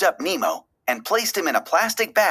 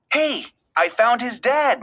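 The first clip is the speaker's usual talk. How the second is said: higher, eager announcement; excited cry; 8 semitones higher than usual. A narrator's reading. Pelican bursting into the office announcing he found the dad. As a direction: burst in proud of the find. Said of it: "Nigel cried".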